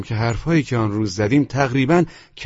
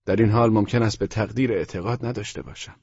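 usual speaking voice

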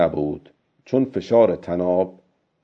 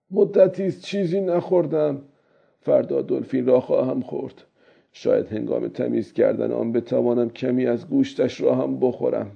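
lightly voiced old man, softer and raised; old fisherman; 5 semitones higher, 5 dB quieter than usual. Old man wondering about eating dolphin meat, lightly voiced. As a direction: muse softly as the old fisherman.

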